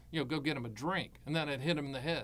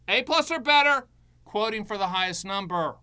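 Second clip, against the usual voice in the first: louder, higher, hammered home; emphatic loud; 4 semitones higher, 8 dB louder than usual. Shown as a demonstration. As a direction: pound the rule home. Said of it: "repeated building mantra".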